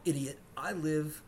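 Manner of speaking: usual speaking voice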